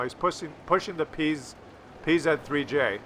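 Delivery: usual speaking voice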